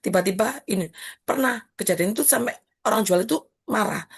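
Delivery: ordinary talk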